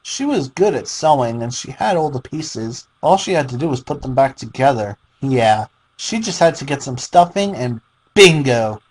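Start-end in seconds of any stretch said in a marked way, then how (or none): none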